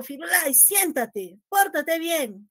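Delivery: firm command. Shown as a demonstration. Teacher showing how she commands her dog, firm and slow. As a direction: order the dog firmly to behave. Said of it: "cambio mi tono de voz".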